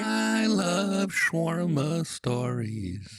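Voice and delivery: high, drawn-out fairy voice; floaty high chant; fairy singsong. Pretend. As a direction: sing it slowly up high. Fairy reacts to the letter.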